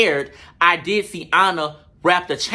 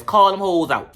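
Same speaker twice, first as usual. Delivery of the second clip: normal talking voice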